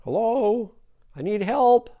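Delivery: high, loud drawn-out calls; high-pitched cry for help; yelling for help. Acted. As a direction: holler up to someone far away. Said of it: "he starts yelling".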